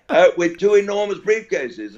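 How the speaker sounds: normal talking voice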